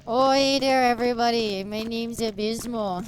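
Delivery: hammed-up Canadian accent; full Canadian accent. Streamer introducing themselves to everybody, playful thick Canadian accent. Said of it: "There's the Canadian accent full stream".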